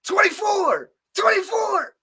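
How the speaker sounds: höher, mock number call, high; imitating Princess. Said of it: "she just came in like".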